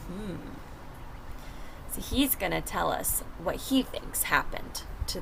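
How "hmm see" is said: ordinary delivery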